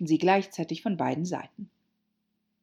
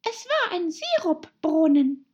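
high, loud little voice; high-pitched small creature; dormouse; 13 semitones higher, 7 dB louder than usual. A narrator's reading. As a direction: announce it in a mouse squeak. Dormouse figure answers.

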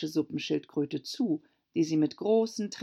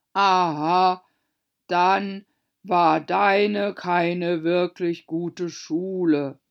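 loud, slow Mock Turtle; greatly relieved; 8 dB louder, much slower than usual. A narrator's reading. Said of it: "mit großer Erleichterung". (sehr erleichtert, laut und gedehnt)

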